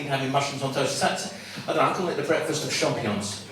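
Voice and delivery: normal talking voice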